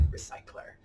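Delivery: whispered echo. Acted. Host whispering the word back to young viewers. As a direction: breathe the word as a secret aside.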